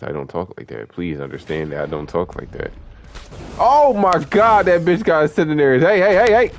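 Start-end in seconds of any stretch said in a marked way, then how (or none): 3.6-6.5 real high, loud panicked shout; startled yelling. Real, not acted. Streamer reacting to an enemy in the game, startled yelling. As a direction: cry out in alarm at a sudden threat. Sudden attack during the game.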